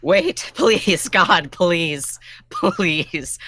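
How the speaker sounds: a mock desperate plea; pleading. Thief begging the captain to take the pants, mock pleading. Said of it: "I'm pleading to the captain".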